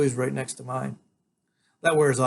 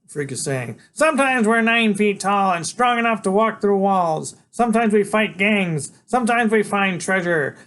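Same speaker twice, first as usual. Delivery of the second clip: bold proclamation; 8 semitones higher, 5 dB louder than usual. Acted. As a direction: proclaim it like a triumphant hero. Freak proclaiming from shoulders.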